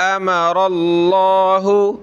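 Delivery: अमरल्लाहु with stretched मा and full heavy अल्लाह; long mim, heavy Allah. Shown as a demonstration. Teacher showing students a drawn mim and heavy Allah. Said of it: "खींचेंगे और लफ्ज़ अल्लाह को मोटा करेंगे".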